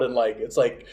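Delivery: ordinary delivery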